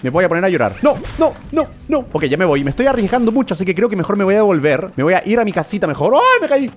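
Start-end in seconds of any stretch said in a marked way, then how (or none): 0.8-2.0 much higher, fast worried pleading; fretting about losing everything; panicked no. Real, not acted. Nearly dying, panics. 6.1-6.7 sudden much higher yelp; startled cry. Real, not acted. Falls in game.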